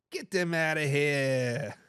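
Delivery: put-on slow, low Boston accent, drawn-out last word. Host redoing a viewer's comment in a Boston accent. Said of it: "best Boston accent I can get".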